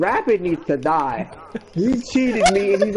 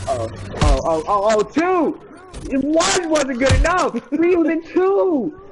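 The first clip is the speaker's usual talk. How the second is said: outraged gamer; 8 semitones higher than usual. Real, not acted. Outraged at double kill.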